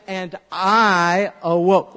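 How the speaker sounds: leading the repeat; slow recitation. Shown as a demonstration. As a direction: say it back slowly. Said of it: "And I say".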